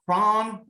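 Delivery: "cron" said short and questioning, one syllable; presenter trying another regional variant, "cron" as a question; cron pronunciation. Shown as a demonstration. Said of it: "all these different pronunciations".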